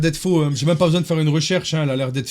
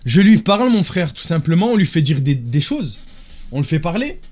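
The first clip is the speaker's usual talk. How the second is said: ordinary talk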